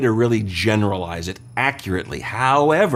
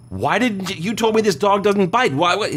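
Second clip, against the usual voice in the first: higher, outraged yelp of a bitten man; indignant outburst; 9 semitones higher than usual. Acted. Bitten man protests to the dog's owner, indignant. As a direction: complain in shock, pitched up high.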